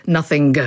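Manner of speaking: "nothing" with a hard G released at the end; pronounced final G. Shown as a demonstration. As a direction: say "nothing" as "nothing-guh". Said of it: "that G was once pronounced".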